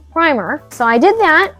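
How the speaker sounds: usual speaking voice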